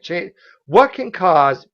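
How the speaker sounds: normal talking voice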